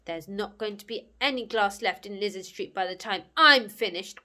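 loud, menacing growl; growled threat. Acted. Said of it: "growled Alvis".

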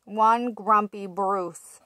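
ordinary delivery

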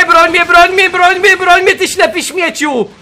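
high, loud repeated demand; exasperated berating. Real, not acted. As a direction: badger loudly, then draw out the insult. Berates his game character.

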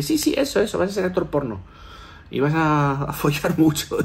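high, eager imitation breaking into a laugh; gleeful, squeaky yes-yes confirmation; excited astrologer. Astrologer confirming he'll be a porn actor, gleeful and laughing.

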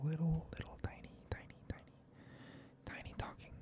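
barely voiced little whisper; tiny whisper. Shown as a demonstration. Showing what tiny talking sounds like, whispered softly. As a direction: shrink the words to a tiny breathy hush. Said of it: "like, a small, very small".

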